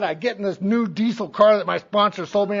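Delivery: ordinary delivery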